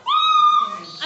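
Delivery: very high, loud scream; piercing, high-pitched "Ah!"; shrieking child. Acted. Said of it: "she shouted".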